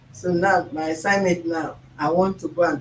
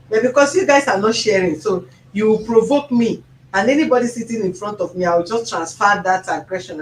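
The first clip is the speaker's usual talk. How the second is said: loud, fast and provoked; rising to a heated outburst; worked up; 3 semitones higher, 6 dB louder than usual. Pretend. Host scolding fans who don't share, loud and heated.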